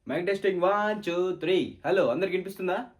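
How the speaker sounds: calling out loud to the whole room; loud mic check. Acted. Student tests the mic before the class, loudly. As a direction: boom it out like testing a mic.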